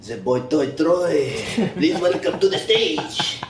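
slow French-accented stage announcer; male French accent. Shown as a demonstration. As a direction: introduce the act in a drawn-out French accent. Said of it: "do a male French accent".

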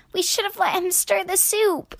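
high, squeaky regretful voice; sniffly squirrel. A narrator's reading. Squirrel regretting not letting duck stir, high sniffle. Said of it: "sniffled the squirrel".

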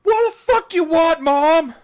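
high slow shout to mom in another room; yelling from another room. Retold or imitated. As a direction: drag it out like an annoyed teenager yelling. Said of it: "when your parents yell at you from the other room".

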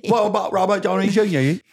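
high-pitched put-on English accent; mock English accent. Host asking about an actor, high mock English accent.

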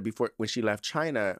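ordinary talk, nothing marked

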